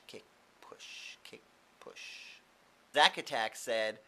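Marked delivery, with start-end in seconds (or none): 0.1-2.4 breathy whispered chant; whispered rhythm. Pretend. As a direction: keep time like pushing a board. Mimicking skateboard rhythm.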